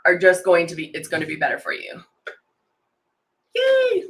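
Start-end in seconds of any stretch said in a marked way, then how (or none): 3.6-4.0 high happy squeal; a bright little yay; excited cheer. Real, not acted. Cheering about pigmented makeup.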